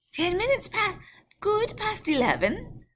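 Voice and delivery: a high, odd little voice for the reply; voiced coachman. Coachman telling the time, high voice.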